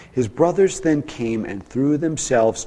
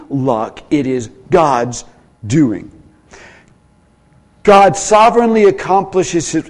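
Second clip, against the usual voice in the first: loud, high, driving sermon voice; preaching at full force; 4 semitones higher, 7 dB louder than usual. Acted.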